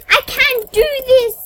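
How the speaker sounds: loud, high despairing shout; real exasperation; frustrated yell. Real, not acted. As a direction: throw the words at the screen. Failing a jump in game.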